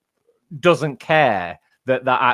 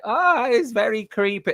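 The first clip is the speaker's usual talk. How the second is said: much higher, loopy creepy voice; loopy, creepy character; 12 semitones higher than usual. Acted. Describing a scary character in a book, loopy high voice. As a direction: play it unhinged and creepy, pitched way up. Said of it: "he's scary in that accent because he's loopy".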